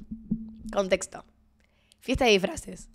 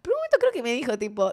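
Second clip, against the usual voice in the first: ordinary talk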